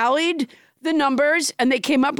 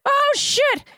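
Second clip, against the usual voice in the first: high falsetto cartoon mouse; squeaky cartoon mouse counting; Mickey Mouse voice. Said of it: "that's the cartoon voice you can do?".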